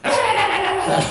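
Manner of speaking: loud, high wordless babble; mock praying in tongues. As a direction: rattle out frenzied prayer noise. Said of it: "방언기도를 해버린 거예요". (방언기도 소리 흉내)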